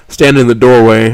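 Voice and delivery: usual speaking voice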